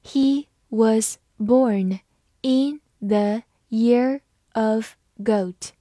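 very slow, word by word; slow, deliberate teaching pace; slow reading. Shown as a demonstration. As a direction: read it slowly with each word set apart. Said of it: "好，慢读一遍".

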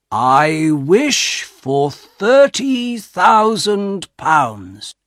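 very slow, weighing each word; slow and careful. Acted. Old father making the fateful wish, slow and careful. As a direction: say the wish slowly and carefully, like a solemn oath. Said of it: "Slowly and carefully".

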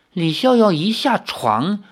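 ordinary delivery

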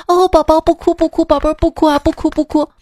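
又高又响地连声哄孩子; 像妈妈急着哄哭闹的宝宝; 哄哭娃. Retold or imitated. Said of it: "然后他妈旁边". (妈妈哄孩子，声音高亢急切)

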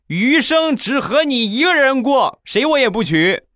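high, slow, syrupy mock-sweet promise; sugary vow. Husband vowing only to love his wife, high and syrupy. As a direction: lay the devotion on thick in a high voice.

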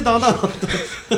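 sung intro jingle, high; humming a jingle. Pretend. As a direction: hum the theme music. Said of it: "然后就音乐起".

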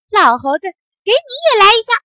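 loud, high mischievous challenge; cheeky taunt. A narrator's reading. Naughty boy throwing a stone at old monkey, cheekily. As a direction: call out, leaping up high at the end.

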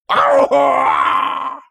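long high scream of pain. A narrator's reading. Underling stamps on king's burned paw, high scream. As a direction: scream as the foot lands. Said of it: "尼采大叫了一声".

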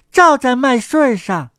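ordinary delivery

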